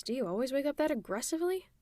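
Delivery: usual speaking voice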